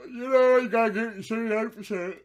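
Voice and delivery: loud, high words stretched by a yawn; talking through yawn. Real, not acted. Talking to viewers while overcome by a big yawn. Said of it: "I don't know why I'm yawning".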